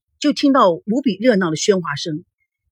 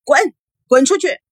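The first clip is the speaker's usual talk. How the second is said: high-pitched order to leave; frantic banishing cry; furious yell; 7 semitones higher, 4 dB louder than usual. A narrator's reading. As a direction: drive him away angrily. Daughter ordering visitor out.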